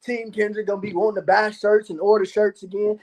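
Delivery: ordinary talk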